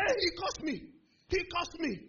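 high, loud outraged complaint; very angry. Retold or imitated. Big student telling the crowd he was cursed, high mock outrage. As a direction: mimic an alarmed, aggrieved bully. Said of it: "the guy got very angry".

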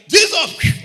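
screamed high and loud in terror; man in the burning plane shrieks Jesus; desperate shout. Retold or imitated. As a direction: cry out the name as the plane burns.

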